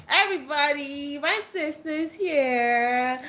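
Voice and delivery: high, slow, drawn-out announcement; delighted sing-song announcing; excited. Real, not acted. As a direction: stretch it out with glee. Announcing sister joined stream.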